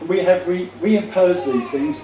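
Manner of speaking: high-pitched, insistent listing; emphatic preaching. Real, not acted. Preacher charges believers with rebuilding barriers, emphatic preaching. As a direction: hammer each charge home from the pulpit.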